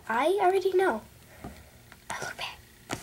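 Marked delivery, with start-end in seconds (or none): 2.1-3.0 unvoiced self-pitying aside; whisper. Real, not acted. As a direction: whisper it to yourself. Aside about her looks.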